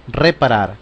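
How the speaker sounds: normal talking voice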